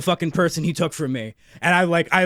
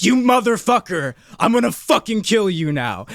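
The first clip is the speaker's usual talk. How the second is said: louder, higher mock yell of revenge; retold furious yell; 5 semitones higher, 4 dB louder than usual. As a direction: re-enact yelling at the villain, half-acted. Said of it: "I was fucking screaming and crying".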